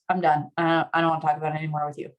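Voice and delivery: monotone, defeated refusal; shut-down withdrawal. Acted. Partner giving up on an argument, shut-down. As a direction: let all the fight drain out. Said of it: "but from a dorsal place".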